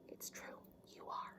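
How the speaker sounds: hushed, breathy insistence; whisper. A narrator's reading. Mom telling Noodle in a hushed whisper. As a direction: lean in and whisper it.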